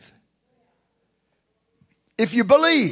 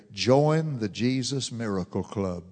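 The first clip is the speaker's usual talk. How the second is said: low and soft, a calm first take; low soft announcement; 9 semitones lower, 7 dB quieter than usual. Real, not acted. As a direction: set it down quietly before repeating it. Repeated slogan, soft take.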